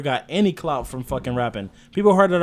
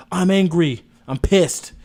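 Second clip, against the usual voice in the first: loud, high mock angry line; mock angry rapper; 4 semitones higher, 7 dB louder, much slower than usual. Mocking weak lyrics on a podcast, loud mock anger. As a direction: ham up a whiny angry rapper. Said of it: "you don't wanna be like".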